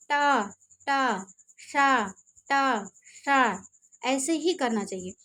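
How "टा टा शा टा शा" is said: टा and शा said slowly with the tongue curled back; retroflex sounds demo. Shown as a demonstration. Teacher models retroflex टा and शा for students, slow and exaggerated. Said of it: "जैसे कहते हैं".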